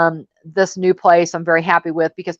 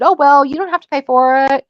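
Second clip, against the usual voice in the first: mock blasé; 7 semitones higher, 3 dB louder than usual. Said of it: "everybody's blasé attitude".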